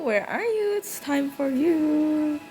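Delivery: ordinary talk, nothing marked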